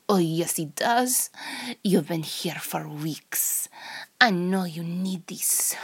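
put-on Russian accent, often half-whispered. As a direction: play a teasing, menacing Russian seductress. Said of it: "in a Russian accent".

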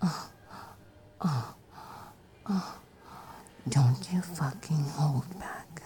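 low breathy pretend moans; low moaning. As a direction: groan it low and hungry. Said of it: "Or maybe".

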